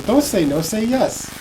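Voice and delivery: high, pleading little brother; mock toddler whine; little kid voice. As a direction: mimic a small kid begging. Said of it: "he go".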